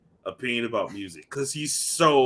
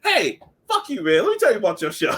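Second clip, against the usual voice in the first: much higher, mock-heated phone rant; imitating Cole Jackson; 9 semitones higher, 4 dB louder than usual. Cole Jackson calling the host to rip his show, high mock outrage. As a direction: play a friend calling up to chew him out. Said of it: "he'll call me and be like".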